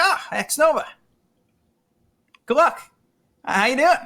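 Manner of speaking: high-pitched starstruck hello; eager fan greeting. Retold or imitated. Retold fan greeting his idol.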